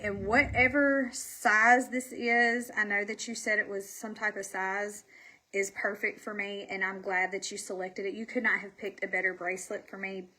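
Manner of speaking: normal talking voice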